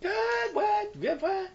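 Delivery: squeaky, high disbelief; a stunned, high-pitched what; shocked. Real, not acted. Shocked at being both wrong.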